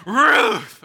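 high, loud bark; talking dog. Acted. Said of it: "says the dog".

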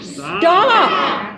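high sharp stop; shouted stop. Shown as a demonstration. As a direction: cut the spiral off with a high cry. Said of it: "want to just shout, "Stop".

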